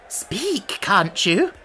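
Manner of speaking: high, impatient burst; impatient cry. A narrator's reading. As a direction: urge him to speak, losing patience. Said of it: "Hare cried impatiently".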